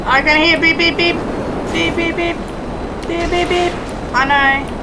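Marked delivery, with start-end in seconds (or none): 0.6-3.7 even, monotone beeps in quick threes; imitated beeping. Player copies the beeping they can hear, monotone beep imitation. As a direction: mimic an electronic alert with your voice.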